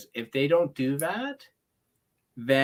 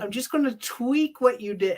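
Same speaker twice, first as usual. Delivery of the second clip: smug superior; 9 semitones higher than usual. Retold or imitated. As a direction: lord it over him with a smirk. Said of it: "and she's like".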